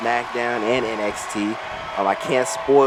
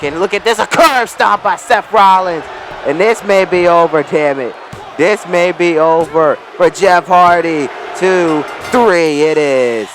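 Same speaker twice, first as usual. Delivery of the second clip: excited wrestling play-by-play, higher and louder; wrestling commentator; 6 semitones higher, 6 dB louder than usual. Acted. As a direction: call the match like a ringside announcer. Live play-by-play of game match.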